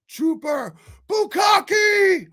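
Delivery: high, loud, stretched-out call of the viewer's name; shout-out. Real, not acted. Host hyping up a viewer in chat with a loud call. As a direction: call the name out like a hype announcer.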